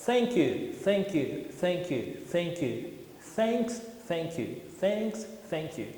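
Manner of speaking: "thank you" repeated softly, with air through the teeth; "thanks, thank you" slowed down, with the tongue between the teeth; blown th drill. Shown as a demonstration. Teacher drills the blown th slowly. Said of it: "Ou então".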